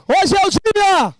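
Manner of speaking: high, loud, drawn-out slogan; rallying shout. Shown as a demonstration. Host leads the hall in a slogan, rallying shout. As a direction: shout it for a crowd to echo.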